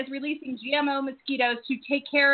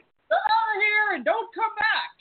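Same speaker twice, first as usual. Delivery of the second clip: shouting angry voice; 10 semitones higher, slower than usual. Retold or imitated. Mimicking the clip's angry voice.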